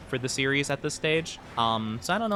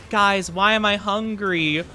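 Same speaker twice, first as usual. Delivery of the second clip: slow, louder whiny question; mock whiny chat; 6 semitones higher, 4 dB louder, slower than usual. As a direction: mimic chat's whine. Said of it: "you guys could be like".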